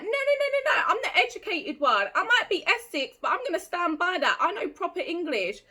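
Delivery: high-pitched, insistent self-defence; indignant protest. Retold or imitated. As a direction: protest in a high, rapid-rising voice. Acts out protesting she is educated.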